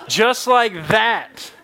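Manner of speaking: very slow, drawn-out emphasis; dramatic slow. Shown as a demonstration. Preacher redoing the miracle line, very slow. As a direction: stretch it out for wonder. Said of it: "redoing the line with more drama".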